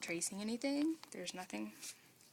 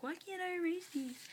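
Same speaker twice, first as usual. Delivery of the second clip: higher, puzzled complaint; whiny frustrated student; 9 semitones higher than usual. Retold or imitated. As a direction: voice a frustrated beginner. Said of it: "you're gonna be like".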